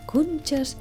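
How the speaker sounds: usual speaking voice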